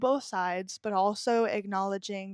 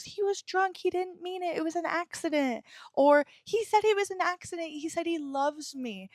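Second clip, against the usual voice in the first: much higher, breathy-sounding parody of excuses; mock naive victim; 8 semitones higher than usual. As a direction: mimic a victim defending her partner. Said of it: "It doesn't matter if they're on drugs".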